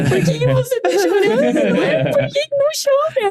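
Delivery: mock-tearful, high-pitched wail; choro imitado. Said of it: "eu chorei junto". (imitando choro, voz bem aguda)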